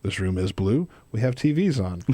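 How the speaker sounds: low monotone narration of the obvious; deadpan sign-reading, self-parody; mock monotone. Shown as a demonstration. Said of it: "in a monotone fashion".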